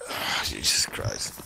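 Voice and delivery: slow whispered groan of frustration; exasperated whisper. Real, not acted. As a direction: breathe it out in defeat. Dying in game, exasperated.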